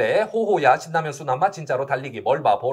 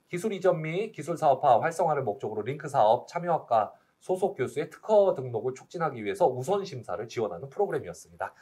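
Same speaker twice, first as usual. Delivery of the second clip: normal talking voice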